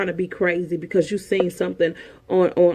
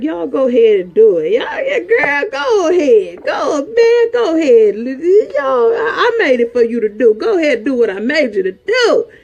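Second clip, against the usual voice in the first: high, loud, crying-out preacher's God; 6 semitones higher, 5 dB louder than usual. Acted. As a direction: cry out as God urging them on, loud and high. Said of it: "God, like".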